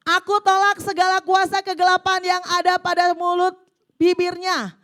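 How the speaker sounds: loud, impassioned prayer voice read to the congregation; fervent prayer reading. Acted. Preacher reading a wife's prayer aloud, fervent and loud. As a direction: cry out the prayer like a battle cry.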